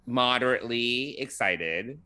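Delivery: slow, halting, picking each word; measured and guarded, with long pauses; careful word choice. Real, not acted. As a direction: hesitate before each word, then stretch it out. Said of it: "I'm trying to choose my words carefully".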